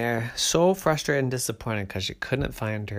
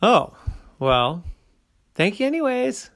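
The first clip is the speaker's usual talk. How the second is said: high, excited little bear cub voice; a bright eager cub asking around; 10 semitones higher, 6 dB louder than usual. A narrator's reading.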